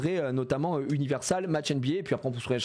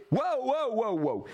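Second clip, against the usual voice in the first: wow; 9 semitones higher, slower than usual. Real, not acted. Reacts on stream.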